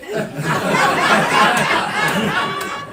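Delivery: airy wheezing laugh, no voice; breathless laugh. Real, not acted. Comic telling his class story, wheezing with laughter. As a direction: let a silent laugh shake out. Laughs at kid's vodka answer.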